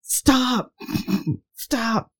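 high plea, fading away; mock exasperated plea. Son's plea, repeated and fading.

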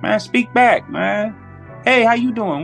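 ordinary talk

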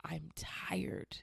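imitated weak, whispery mumble; tearful mumble. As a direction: mumble it faintly, as if through tears. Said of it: "I just mumbled".

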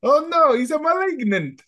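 high panicked hammed-up Italian accent. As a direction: wail it in a cartoon Italian accent. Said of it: "could you do that in an Italian accent".

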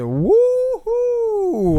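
loud, high drawn-out woohoo; big rousing cheer; high whoop. Pretend. Imitates the energising jolt of listening.

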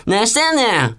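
lightly mocked, high Kansai retort; 関西弁の女の真似. Mimicking Kansai women's retort. (関西弁の女を軽く真似た高い声)

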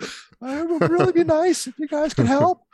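retelling a nervous squeaky church appeal; nervous high voice. Retold or imitated. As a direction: squeak it out like a scared first-time speaker at church. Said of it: "my voice went real high".

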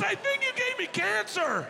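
a dad sure he's been poisoned; panicked yelling dad. Retold or imitated. Dad to son in the smoky room, high outraged yelling.

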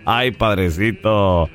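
slow, low chuckled reply; laughing mayor. Acted. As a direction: laugh into it, then drawl it low. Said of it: "Y se ríe el alcalde".

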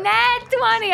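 very high-pitched triumphant cry; real excitement. Real, not acted. Player celebrates a perfect roll, excited squeal. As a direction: crow it in triumph.

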